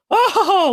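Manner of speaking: high-pitched imitation of a giggling girl; imitated high "ah-ha-ha" giggle; mock giggle. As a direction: put on a high, playful laugh. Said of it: "laughing, giggling".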